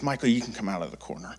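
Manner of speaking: tender retold mother; gentle. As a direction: soften it like a calmed mom. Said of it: "she gently puts her hand".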